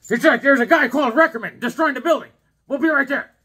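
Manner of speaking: high, loud mock newsman; hammed-up old-time reporter on the job. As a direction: bellow the breaking news urgently. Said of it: "the reporter had one of those hats".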